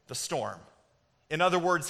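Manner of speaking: usual speaking voice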